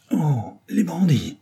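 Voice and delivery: high, slow exclamation, no real shout; drawn-out outcry, pitched high; indignant cry. A narrator's reading. Mechanic reacts to news of a murder, crying out. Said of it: "cria Mécano".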